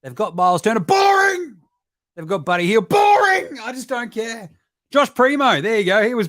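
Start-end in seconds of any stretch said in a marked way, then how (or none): 0.8-1.6 high, loud "Boring!"; yelled boring; mocking shout. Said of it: "Oh, but you see". 2.9-3.6 high, loud "Boring!"; yelled boring; mocking shout. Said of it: "Oh, but you see".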